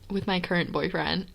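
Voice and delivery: ordinary talk, nothing marked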